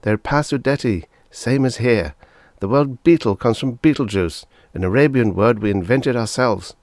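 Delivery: put-on a kid patiently explaining; lightly voiced earnest boy. A narrator's reading.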